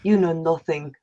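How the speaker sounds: low, soft in-character rebuke; cold dismissal, dropping to a murmur; quiet contempt. Acted. Acted bitter rebuke to her master.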